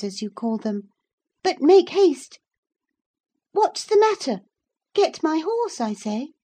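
put-on high, loud girlish orders; bossy girl, impatient. Girl orders the lad to fetch her horse, high and imperious.

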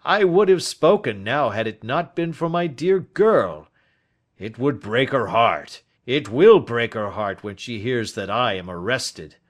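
loud, emphatic outpouring, starting high; anguished old Turner. Acted. Old man fearing his daughter's heartbreak, anguished. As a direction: protest with distress for a beloved daughter.

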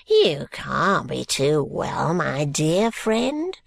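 put-on slow, gasping, wheezing old man; a decrepit old miser catching his breath. Grandfather Smallweed greeting George, slow and gasping.

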